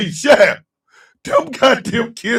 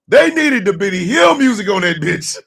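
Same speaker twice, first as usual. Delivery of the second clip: usual speaking voice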